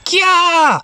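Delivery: high, short scream; startled shriek. Acted. Mimi finds a Numemon in the machine, shrieking.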